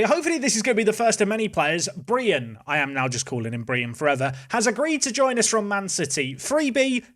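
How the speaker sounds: usual speaking voice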